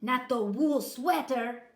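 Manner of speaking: loud, slow, horrified; dread. A narrator's reading. Kitten hearing the bully's sweater, horrified. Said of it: "said Scapito".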